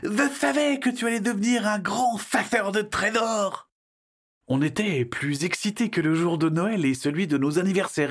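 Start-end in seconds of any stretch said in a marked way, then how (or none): 0.0-3.7 put-on high-pitched, thrilled child character; excited child. Child cheering a future treasure hunter, excited high voice.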